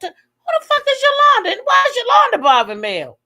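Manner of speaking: high, loud, hammed-up bewilderment; exasperated outburst. Host demanding answers about a stranger, retold exasperated outburst. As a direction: yell the retold questions way up high. Retells own baffled reaction.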